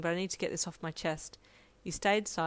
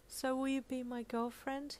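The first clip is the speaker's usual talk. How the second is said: soft, high, slow and tender; gentle question; 6 semitones higher, 7 dB quieter than usual. A narrator's reading. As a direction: ask shyly and very softly. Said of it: "he asked gently".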